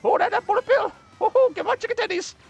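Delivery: very high, loud squeaky taunting voice; Evan impression. Streamer imitating friend Evan taunting in Mario Kart, very high squeaky voice. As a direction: cackle and taunt like a giddy cartoon gremlin. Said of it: "that's Evan playing Mario Kart".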